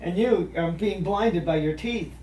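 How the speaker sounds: high, loud squawking cartoon duck; Donald Duck voice. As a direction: roast them as a quacking duck. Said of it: "roast ... in the Donald Duck voice".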